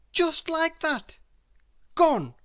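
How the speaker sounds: high, slow, emphatic gasp of disbelief; dramatic exclamation. A narrator's reading. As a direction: make it a big dramatic moment. Dramatic narration of loss.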